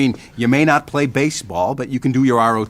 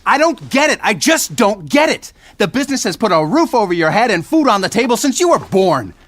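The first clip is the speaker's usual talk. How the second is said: high, loud exasperated shouting; father blowing up at his son; angry outburst; 9 semitones higher, 3 dB louder than usual. Acted. Father shouting at son over the family business, exasperated. Father explodes at son's career plan.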